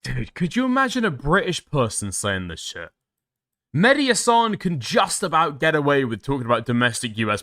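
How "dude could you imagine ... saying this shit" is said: ordinary talk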